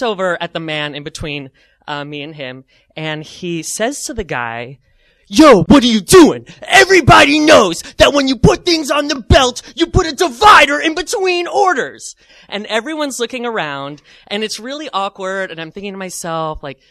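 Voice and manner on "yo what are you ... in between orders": loud, high mock-angry shouting; angry customer. Angry customer scolding the man ahead in line, loud mock outrage. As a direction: berate him like an irate shopper.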